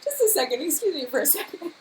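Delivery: high, choked-up excuse; real tearful request for a moment; crying. Real, not acted. Streamer stepping away from viewers, tearful. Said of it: "I'm actually crying".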